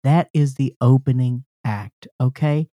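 mock concert announcer intro. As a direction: introduce the band like an emcee. Hosts a concert-style introduction.

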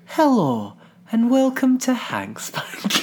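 a clumsier, lightly mocked host voice; worse alternate take. Shown as a demonstration. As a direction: deliberately botch the soothing intro. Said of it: "See, that would have been much worse".

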